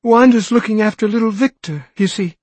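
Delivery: put-on a mother fearing for her small kids; anxious pleading. Frantic mother explains.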